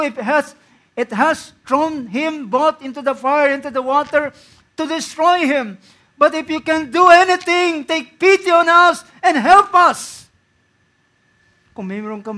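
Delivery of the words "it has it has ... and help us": building from raised to loud, slowing to plead; preacher voicing the father's desperate plea; rising impassioned reading. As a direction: read the plea with growing urgency.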